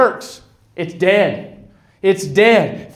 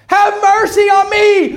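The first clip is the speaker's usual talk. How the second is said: high, loud acted plea; crying louder; 13 semitones higher, 4 dB louder, slower than usual. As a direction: shout for mercy at the top of the voice. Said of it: "He cried louder".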